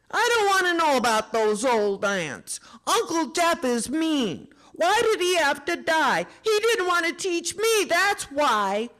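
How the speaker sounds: put-on high, loud tearful boy; angry crying child. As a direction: shout it through tears.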